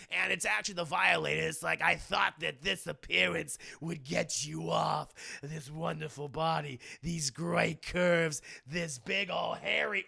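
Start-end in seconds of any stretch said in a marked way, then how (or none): 1.8-10.0 slow, put-on demon leer; leering villain. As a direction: savour each phrase like a creepy demon. Plays the Violator, leering.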